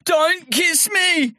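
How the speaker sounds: high, slow pleading. A narrator's reading. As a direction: beg her to stop. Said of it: "he pleaded".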